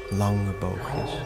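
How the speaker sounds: ordinary talk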